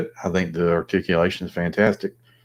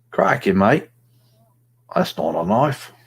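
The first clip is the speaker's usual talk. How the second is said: bad, hammed-up Australian accent, pitched higher; Paul Hogan Australian accent; 5 semitones higher than usual. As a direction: put on a terrible mock-Aussie accent. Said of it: "Australian accent. That's terrible".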